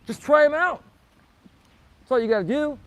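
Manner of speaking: loud, high, urging shout; excited shout. Real, not acted. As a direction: yell it to fire them up. Repeats the pitch, peak.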